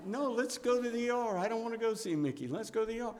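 put-on high, eager little-boy voice; eager child. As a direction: beg to go like an excited kid.

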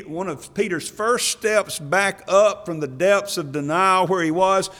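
high, loud impassioned preaching; rising fervent preaching; preaching at full force. Real, not acted. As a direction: build the sermon to a loud, high peak.

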